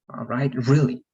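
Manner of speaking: ordinary talk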